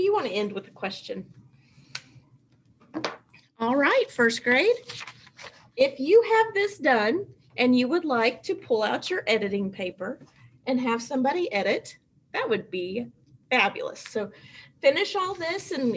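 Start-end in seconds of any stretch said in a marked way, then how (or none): none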